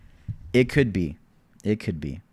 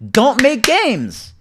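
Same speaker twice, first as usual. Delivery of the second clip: drawn out, high and loud; slow emphatic; 13 semitones higher, 8 dB louder, much slower than usual. Real, not acted. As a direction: spell it out slowly. Ranting at the developer.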